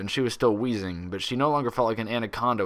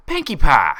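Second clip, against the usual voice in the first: sharp, loud, higher bark of a name; 6 semitones higher, 13 dB louder than usual. A narrator's reading. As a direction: bark her name to stop her. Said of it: "Applejack barked loudly".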